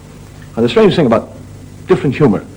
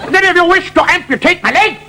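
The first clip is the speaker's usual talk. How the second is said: high, slow mock German patient; German accent. German patient agreeing to lose his leg, comic accent. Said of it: "The Germans".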